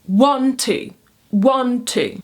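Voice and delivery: falling two-beat count, weight on each "One"; stressed "One", unstressed "two"; trochaic stress. Shown as a demonstration. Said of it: "starts on a stressed syllable and ends".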